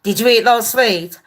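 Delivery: a hint of a stern teacher; stern teacher quizzing. Teacher quizzing a child over sweets, lightly stern. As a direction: grill them like a teacher. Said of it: "it's like".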